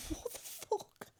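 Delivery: breathless, voiceless words through laughter; slow, soft wheeze of disbelief; laughing disbelief. Real, not acted. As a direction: laugh so much the words barely come out. Laughs helplessly at next confession.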